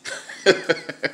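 breathy suppressed giggle; stifled laugh. Real, not acted. Prank works, dog runs off.

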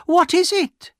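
loud, very high demand to know; insistent cry. A narrator's reading. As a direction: cry it out at the top of your voice.

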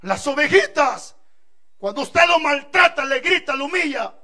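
alto y fuerte, lento al empezar; predicación enfática. Acted. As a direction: clamar por las ovejitas indefensas. Predica con fuerza. (el predicador defiende a las ovejitas con voz fuerte)

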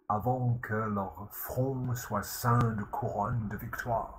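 French translation. Shown as a demonstration. Lecturer translating the line into French. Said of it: "French translation of the line".

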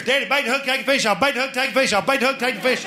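put-on high nagging kid voices; whiny daughters. Comic dad mimicking daughters nagging him at the pond, high and whiny. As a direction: mimic the daughters pestering daddy.

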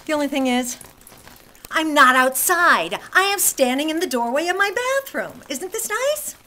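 loud exclaiming. Real, not acted. Woman shows viewers rain pouring into the bathroom, loud exclaiming. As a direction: exclaim it loudly over the rain.

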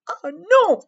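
high, loud indignant cry; girl's protest. Acted. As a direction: refuse the dragon boldly. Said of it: "répond Petite Sœur Lee". (petite fille qui proteste)

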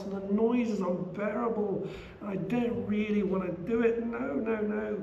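put-on high, pleading voice of a frightened young warrior; high, anxious, pleading Arjuna; distressed Arjuna. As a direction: raise the voice high and beg to be let off the fight. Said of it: "And Arjuna goes".

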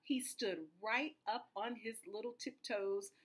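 plain reading voice

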